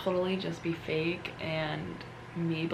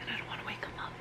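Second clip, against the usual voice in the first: soft whisper, barely voiced; 4 semitones higher, 8 dB quieter than usual. Real, not acted. Explaining to viewers why she whispers, in a whisper. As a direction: keep it hushed, people are sleeping. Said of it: "I'm whispering".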